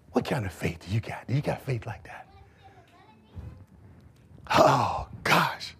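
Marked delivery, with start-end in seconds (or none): none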